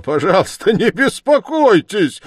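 high, loud outburst; crying out wildly. A narrator's reading. Suspect bursting out at the investigator's concern, wild cry. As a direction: exclaim it wildly, pitch shooting up. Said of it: "вскричал Раскольников и вдруг захохотал".

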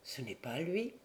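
low, hushed murmur, a little thrown. Acted. Guivarc at the door, murmuring in doubt. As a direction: murmur it to himself, puzzled. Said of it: "Il murmura, un peu déconcerté".

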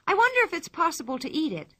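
put-on high, quick girlish wondering; lively girl voice. A narrator's reading. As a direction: wonder aloud, brightly and quickly. Pippi wonders aloud.

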